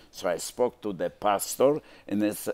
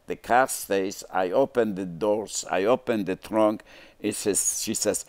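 ordinary talk

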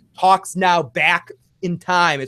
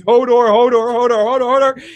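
higher, louder Hodor, Hodor; imitating Hodor; 6 semitones higher, 5 dB louder, faster than usual. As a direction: cry the name out in a fit.

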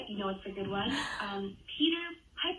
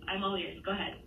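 ordinary talk

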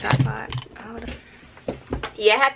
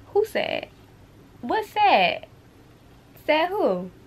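mock innocence; 9 semitones higher, much slower than usual. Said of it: "I'm trolling".

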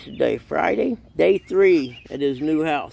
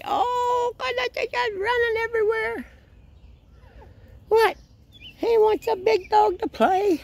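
high pet talk. Real, not acted. Owner baby-talking to his new puppy in a much higher voice.

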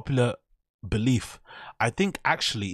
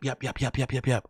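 ordinary talk

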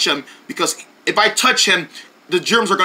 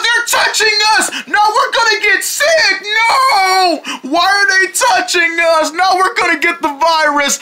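panicked shrieking; 10 semitones higher than usual. Acted. Deerlings shriek as the bugs touch them. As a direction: shriek in terror of germs.

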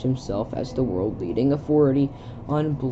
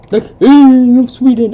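grand announcer; 9 semitones higher, 14 dB louder than usual. Acted. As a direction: announce it like a herald.

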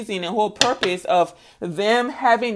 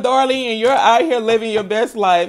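ordinary talk

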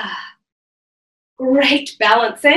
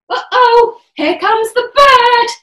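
mock alarm; 9 semitones higher, 5 dB louder than usual. Worm game, bird approaching.

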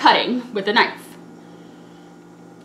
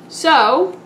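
ordinary delivery

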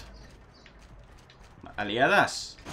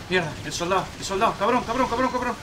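rising panic, swear faster; frantic cursing; 6 semitones higher than usual. Real, not acted. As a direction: pitch climbing, panicked swearing. Soldado en peligro, maldice. (maldiciendo con pánico creciente)

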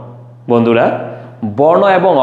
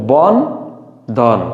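"বন", short pause, then "ধন"; separated syllables; slower than usual. Shown as a demonstration. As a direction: give "বন" and "ধন" each its own beat. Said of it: "আমাদের সীমাবদ্ধতা অতটুকু". ("বন" আর "ধন" ভেঙে ভেঙে, মাঝে বিরতি দিয়ে)

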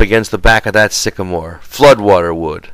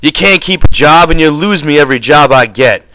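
high-pitched angry tirade, bursts of shouting; heated, raised-voice scolding; furious; 7 semitones higher, 4 dB louder than usual. A narrator's reading. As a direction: lay into your companion with accusations. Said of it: "George went on furiously".

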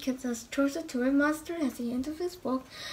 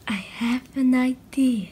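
ordinary delivery

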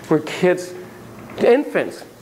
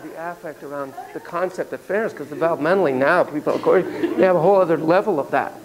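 normal talking voice

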